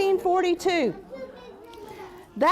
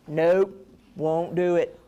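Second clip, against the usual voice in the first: gruff dog; 13 semitones lower, slower than usual. Acted. Dog refuses.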